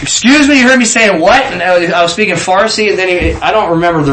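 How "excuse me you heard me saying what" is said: high, incredulous query; retold startled question. Storyteller replaying his reply to the Farsi question, high and startled. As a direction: reenact being taken aback.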